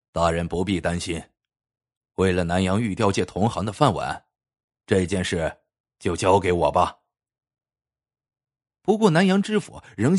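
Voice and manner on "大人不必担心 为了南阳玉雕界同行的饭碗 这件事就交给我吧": low, even, unruffled master craftsman; calm assurance. A narrator's reading. Master carver to worried prefect, calmly reassuring. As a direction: reassure him in a low, composed voice. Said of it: "他淡然一笑说".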